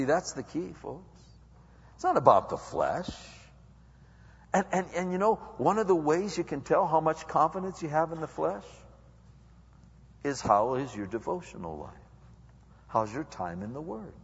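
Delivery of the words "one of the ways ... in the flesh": ordinary talk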